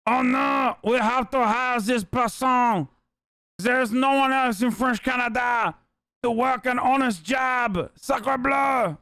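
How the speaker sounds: hammed-up French accent, slow and exclaiming; mock French accent. Streamer correcting himself, mocking officials in French accent. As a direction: redo the quote as a cartoon Frenchman. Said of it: "Sorry, they said it like this".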